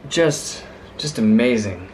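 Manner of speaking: ordinary delivery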